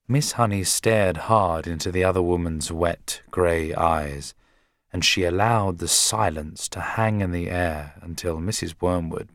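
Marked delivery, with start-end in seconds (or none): none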